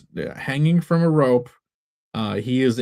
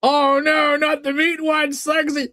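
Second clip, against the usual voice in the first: very high put-on cartoon cry; character voice; 12 semitones higher than usual. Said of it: "very happy that he gets to".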